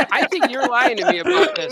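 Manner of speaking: confronting the writer about his theories, mock accusing. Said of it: "And I'm like".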